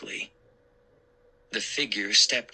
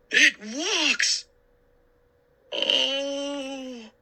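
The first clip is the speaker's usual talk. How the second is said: frightened cry; much slower than usual. Acted. Uncle cries out backing from the mummy. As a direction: back away crying out.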